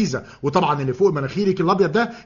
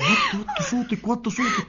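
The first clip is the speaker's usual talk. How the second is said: urgent hushed plea, much softer; hushing; 7 dB quieter than usual. Acted. Husband hushing wife so his mother won't hear, hushed. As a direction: shush her so mother hears nothing.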